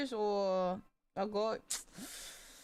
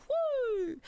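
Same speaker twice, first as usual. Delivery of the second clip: high, loud "woo"; sung whoop; 12 semitones higher, 6 dB louder than usual. Real, not acted. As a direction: let out a high musical whoop. Whoop inside the song.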